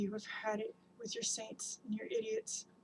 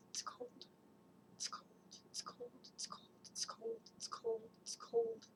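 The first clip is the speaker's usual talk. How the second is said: breathy whispered repeats, barely voiced; shivering whisper; 12 semitones higher, 9 dB quieter than usual. Speaker alone in the cold, whispering it's cold. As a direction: whisper it as if freezing.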